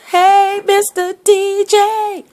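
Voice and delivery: high, slow hammed-up DJ call; mock radio greeting. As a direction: hail the mic like a radio host. Greeting the microphones playfully.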